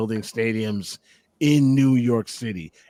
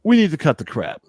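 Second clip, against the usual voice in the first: stern self-reproach; 9 semitones higher, 5 dB louder than usual. Retold or imitated. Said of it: "take a look in the mirror and go".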